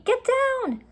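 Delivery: put-on girl warning her brother; urgent call. A narrator's reading. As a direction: yell at him to get down. Urgent warning as treehouse spins.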